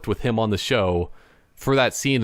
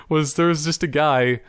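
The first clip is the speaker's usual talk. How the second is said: ordinary talk, nothing marked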